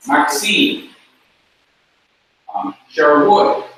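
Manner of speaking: MAXINE and SHERWOOD stressed on the final syllable; last-syllable stress. Shown as a demonstration. Teacher pronouncing students' names the Hebrew way, stress on last syllable. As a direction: land hard on the end of Maxine, Sherwood. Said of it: "emphasizing all the last syllables".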